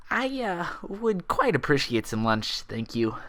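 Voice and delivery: slow, embarrassed and polite; hesitant, blushing acceptance; bashful thanks. A narrator's reading. Twilight thanking the doctor, slow and a bit embarrassed. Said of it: "failing to keep a faint blush".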